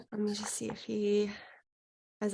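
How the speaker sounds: whispered aside to herself; soft unvoiced whisper. Real, not acted. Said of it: "whispered aside".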